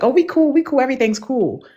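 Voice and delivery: high-pitched imitation of breezy reassurance; acted reassurance. Woman signaling to a man after a hug, mock reassuring. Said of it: "giving him the signs".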